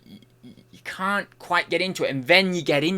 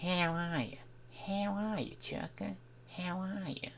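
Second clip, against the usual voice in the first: soft, higher stab at an Australian accent; half-formed Australian accent, under the breath; attempted Australian accent; 4 semitones higher, 15 dB quieter than usual. Shown as a demonstration. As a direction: try out a stock Aussie voice quietly. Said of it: "the way to do that accent yet".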